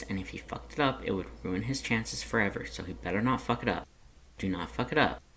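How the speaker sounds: usual speaking voice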